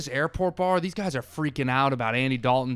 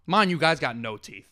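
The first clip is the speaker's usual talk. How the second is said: ordinary delivery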